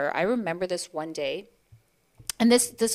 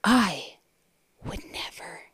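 softer, whispered judgment; disapproving whisper; 4 dB quieter than usual. Retold or imitated. Judgmental mother whispering her disapproval, much softer. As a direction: hush it like a scandal. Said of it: "And she goes".

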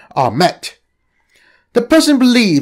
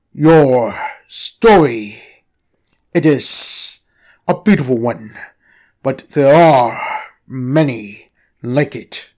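low, very slow serpent voice; mystic snake; 5 semitones lower, slower than usual. Acted. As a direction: speak like an ancient oracle. Snake speaks.

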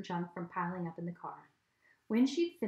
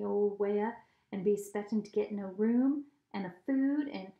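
put-on hillbilly accent. Dad teasing the kids, mock Southern hillbilly accent. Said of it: "Dad did an imitation of a hillbilly accent".

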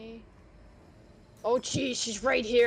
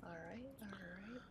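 whispering while hiding; 14 dB quieter than usual. Real, not acted. Sneaking past the enemy.

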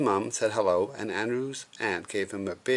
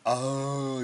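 higher drawn-out groan; storybook yell held back in volume; mild mock yell; 4 semitones higher than usual. A narrator's reading. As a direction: cry out in pretend dismay. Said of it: "yelled Taylor J's".

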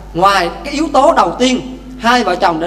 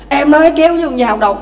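higher, fast talking back; wife hitting back at her husband, in a quick mock quarrel; mock angry wife; 5 semitones higher, faster than usual.

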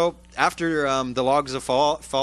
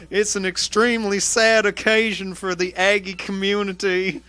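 mock Texas accent, higher; 7 semitones higher, 4 dB louder than usual. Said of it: "let's do all the quotes in a Texas accent".

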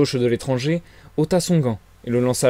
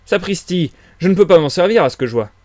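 louder, higher, quick exclamation; disconcerted; 5 semitones higher, 7 dB louder than usual. A narrator's reading. Policeman faced with handless prisoner, disconcerted. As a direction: blurt it out, taken aback. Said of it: "déconfit subitement par l'absurdité".

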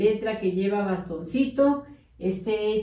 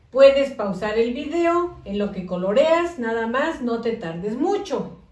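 put-on talking to young kids. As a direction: coax the children along through the worksheet. Teacher addresses small children.

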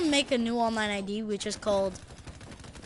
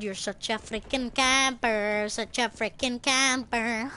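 ordinary delivery

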